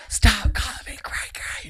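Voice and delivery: high, voiceless strained rasp; imitated angry kid. Little girl fed up with the nickname, strained mock fury. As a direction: squeeze it out like a fuming little girl. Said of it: "she kept going like this".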